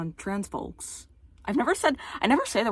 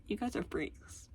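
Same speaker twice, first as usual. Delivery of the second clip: mocking imitation; 2 semitones higher, 11 dB quieter, slower than usual.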